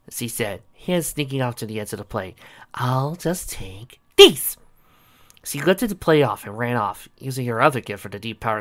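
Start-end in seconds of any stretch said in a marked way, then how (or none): 2.8-4.6 slow, teasing drawl; sly. Acted. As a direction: savor the trick slowly. Sally sneakily grabbing the plate.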